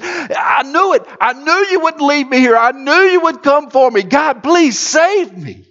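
high, eager plea to be saved; a desperate man calling to God; crying out. Acted. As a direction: beg with relief and hope. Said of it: "He cries out".

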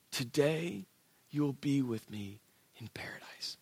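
soft whisper, fading out; whispered promise. Retold or imitated. Jesus whispering to the dying thief, tender.

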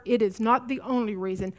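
normal talking voice

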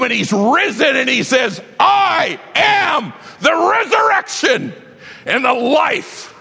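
high, slow, ringing shout; triumphant shout. Acted. Preacher crying out Jesus's victory over the tomb, shouting. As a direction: belt it like the climax. Climax of resurrection sermon.

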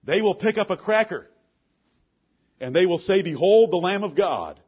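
loud, high, hammering preacher's cadence; fiery preaching. Acted. Preacher exhorting his church, loud and driving. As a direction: preach it to the congregation full force.

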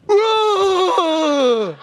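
loud, high, long "whoa"; imitating mom's scare shout; startling jump-out yell. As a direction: leap out with a big spooky holler. Mom jumps out to scare.

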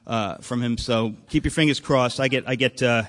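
ordinary talk, nothing marked

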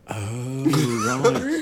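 rising, laughing "right"; mock realisation. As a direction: imitate a room catching on. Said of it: "the whole room go".

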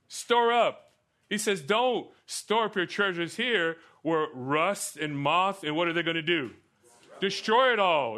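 high, loud preacher's delivery; emphatic preaching. Acted. Preacher teaching the congregation about heaven and eschatology, emphatic preaching. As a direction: drive it home with big projected questions and answers.

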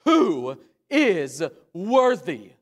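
slow, loud proclamation, each word drawn out; loud angelic cry. Acted. Preacher as the mighty angel calling from heaven, loud slow cry. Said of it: "He cries out with a loud voice".

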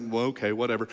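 a lightly voiced, shrugging Jesus; offhand Jesus. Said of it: "Jesus is like".

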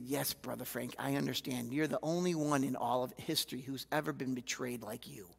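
soft, low mock sympathy; deadpan, quietly needling; dry sarcasm. Fellow pastor teasing angry Frank, soft and sarcastic. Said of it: "he said".

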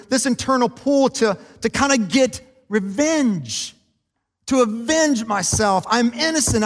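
loud, high, slow preaching, leaning on every word; preaching at full force. Acted. Pastor preaching to his church, building with force. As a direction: drive it home to the whole congregation.

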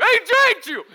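loud, high-pitched needling; badgering. Teasing buddy about his crush.

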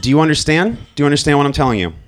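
a dad pushing his son to get it; insistent father. Retold or imitated. Father pressing his teenage son.

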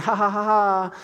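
a hollow, sarcastic laugh; sarcastic fake laugh. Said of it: "I said something real sarcastic".

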